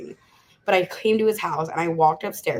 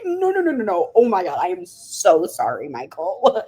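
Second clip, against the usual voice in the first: mock-pleading, rush of no's into an emphatic sorry; panicked apology; 4 dB louder than usual. Acting out panicked apology to her lawyer.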